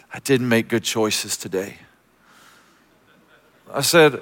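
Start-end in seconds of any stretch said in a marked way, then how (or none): 0.1-1.8 put-on ashamed little girl. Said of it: "hangs her head in shame".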